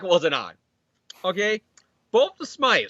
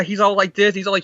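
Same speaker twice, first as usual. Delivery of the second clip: ordinary talk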